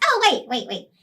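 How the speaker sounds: high hurried wait wait; urgent interruption. Real, not acted. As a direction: cut in quickly. Child interrupts the story.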